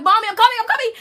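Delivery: excited urgent calling. Retold or imitated. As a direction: hurry to reach mom. Calling to mom on phone.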